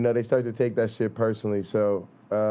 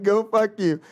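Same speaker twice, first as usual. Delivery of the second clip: playful retort; 12 semitones higher, 5 dB louder than usual. Retold or imitated. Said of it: "And I'll be like".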